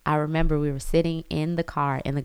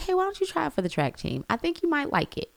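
high, light encouraging mom voice, quicker; sweet mom voice; 12 semitones higher, faster than usual. Retold or imitated. Mother nudging her daughter toward track, high and sweet. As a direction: coax her gently, voice lifted high. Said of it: "I was just like".

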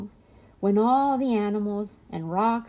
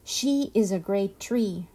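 plain reading voice

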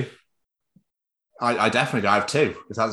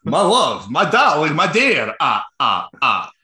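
lightly voiced Dracula; a hint of a hammy Dracula; 3 semitones higher, 3 dB louder, slower than usual. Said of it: "to get you to do the voice".

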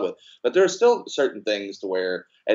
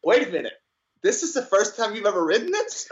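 mock surprise; 11 semitones higher than usual. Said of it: "he's like".

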